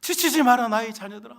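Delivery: firm command building loud and high; Jesus commanding his children, rising loud and high. Acted. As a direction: call out like the Lord urging his children on. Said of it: "이게 예수님의 음성이에요".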